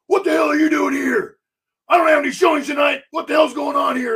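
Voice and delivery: high furious shout; angry shout. Retold or imitated. Homeowner yelling at the realtor in his doorway, furious shout.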